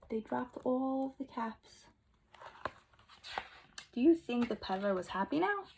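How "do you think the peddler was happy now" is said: usual speaking voice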